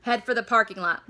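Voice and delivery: loud barked command; big boy yelling at his gang; yelled order. Acted. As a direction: yell at the gang to move. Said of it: "one of the big boys yelled".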